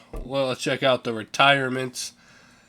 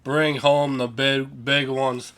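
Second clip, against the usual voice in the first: sports announcer voice; 6 dB louder than usual. Pretend. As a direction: read it like a TV sportscaster. Season recap in broadcast voice.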